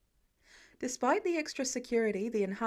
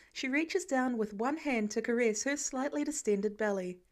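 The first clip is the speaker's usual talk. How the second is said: ordinary delivery, nothing marked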